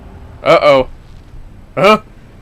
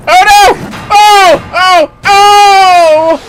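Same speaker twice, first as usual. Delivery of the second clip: loud, higher bursts of alarm; startled cries; 11 dB louder, much slower than usual. Real, not acted. Streamer reacting to boss attacks, loud startled yelps. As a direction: yelp at each hit.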